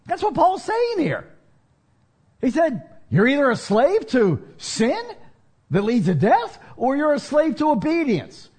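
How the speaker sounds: high, rising preacher's exhortation; preaching at full force. Acted. Preacher urging his church, rising in passion. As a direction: drive the point home, climbing.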